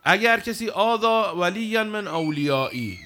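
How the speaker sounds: loud, slow Arabic recitation; solemn recitation. Acted. Preacher reciting the Arabic hadith to the congregation, loud and slow. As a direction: intone the hadith slowly.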